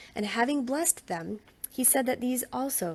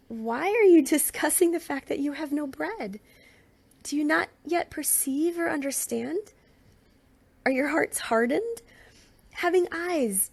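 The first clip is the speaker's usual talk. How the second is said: raised, higher, insistent questioning; exasperated rebuke; 6 semitones higher, 3 dB louder than usual. Acted. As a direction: press them hard, almost pleading. Jesus rebuking disciples, raised voice.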